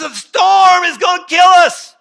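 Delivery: high, very loud alarmed shout; yelling. Acted. Disciples waking Jesus about the storm, yelling in alarm. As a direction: shout it in acted panic. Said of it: "What are y'all yelling about?".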